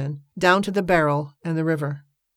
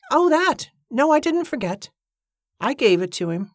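simple Mattie; 8 semitones higher than usual. Acted. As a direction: play the innocent, offhand. Mattie's voice in dialogue.

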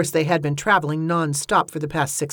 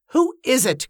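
high-pitched call, only slightly raised in volume; mild shout; 7 semitones higher than usual. A narrator's reading. Cornelia calls to whoever is knocking, mild high shout. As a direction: demand to know, pitched up. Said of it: "Cornelia shouted".

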